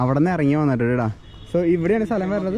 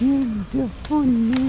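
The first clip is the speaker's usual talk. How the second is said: long, high amazed exclamation; drawn-out awe; 7 semitones higher, much slower than usual. Real, not acted. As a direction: stretch it out in wonder. Watching stunt in awe.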